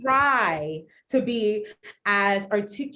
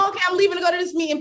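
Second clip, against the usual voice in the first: fast rushed; 7 semitones higher, 5 dB louder, much faster than usual. Retold or imitated. Imitating herself hurrying.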